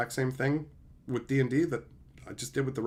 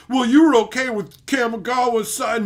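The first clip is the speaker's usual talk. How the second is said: high, loud put-on whiny voice; mocking gotcha; 9 semitones higher, 9 dB louder than usual. Mocking a critic's gotcha, high and loud. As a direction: whine it as a smug critic. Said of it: "be like".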